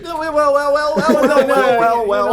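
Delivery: high, loud mocking well, well; smug gloating voice. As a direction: gloat like a cartoon villain. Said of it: "He's like".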